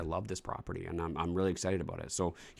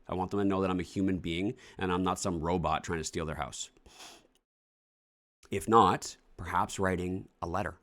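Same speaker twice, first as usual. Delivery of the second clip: normal talking voice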